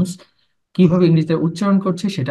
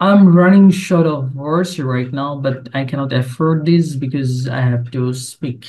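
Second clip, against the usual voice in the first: slow repeat; 2 semitones lower, much slower than usual. Shown as a demonstration. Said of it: "Okay, here".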